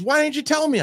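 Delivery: very high mock squeal of blame; accusing wife. Husband imitating his wife's shocked accusation. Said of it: "she goes".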